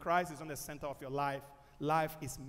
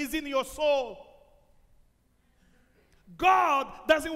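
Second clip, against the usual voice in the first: loud, urgent sermon delivery; full-force preaching; 11 semitones higher, 10 dB louder than usual. Acted. Preacher pleading with the congregation, loud and urgent.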